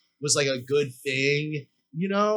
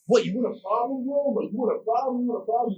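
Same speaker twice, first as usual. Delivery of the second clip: higher, repeated challenge; mock confrontational; 5 semitones higher, faster than usual. Puffer jacket guy challenging a stranger, acted confrontation. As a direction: act out a man picking a fight. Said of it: "the two guys start arguing".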